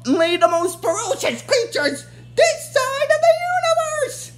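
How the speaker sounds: villain queen bragging about her new warriors; Rita boasting. Retold or imitated. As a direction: boast of the fearsome monsters grandly. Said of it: "she's like".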